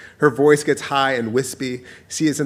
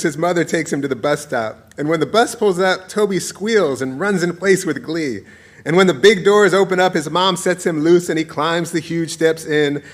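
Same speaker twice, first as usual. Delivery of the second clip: usual speaking voice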